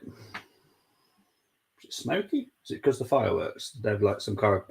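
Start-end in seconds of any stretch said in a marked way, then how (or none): 0.0-2.5 high, slow, drawn-out puzzled question. Real, not acted. Streamer wondering aloud why the stadium looks hazy, slow high puzzlement. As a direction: stretch it out, squinting at the screen.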